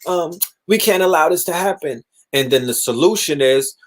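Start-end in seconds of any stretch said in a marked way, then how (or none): 0.1-2.0 higher-pitched fake outrage, lightly done; a hint of hand-wringing outrage; mock outraged public. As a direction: lightly mimic the fake-shocked crowd. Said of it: "Everybody's like".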